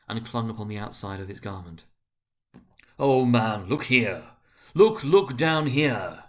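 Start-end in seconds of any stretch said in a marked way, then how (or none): 3.0-6.2 loud, urgent cry, last words slow; exclaimed. A narrator's reading. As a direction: demand that he look down. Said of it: "exclaimed the ghost".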